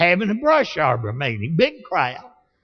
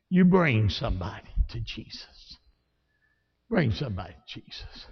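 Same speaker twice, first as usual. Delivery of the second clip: quiet plea; 8 semitones lower, 8 dB quieter than usual. Real, not acted. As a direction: lower and much softer, earnest. Quiet earnest appeal.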